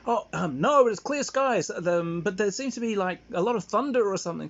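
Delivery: puzzled Dad. Retold or imitated. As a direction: imitate a naive dad reporting in. Dad mistakes artillery for thunder.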